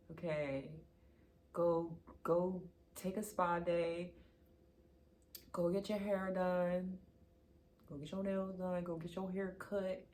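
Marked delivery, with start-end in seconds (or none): none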